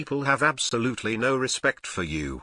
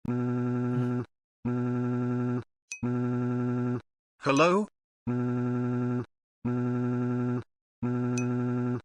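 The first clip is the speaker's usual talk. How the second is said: steady robotic hum; 3 dB quieter, much slower than usual. Pretend. Humming beeps.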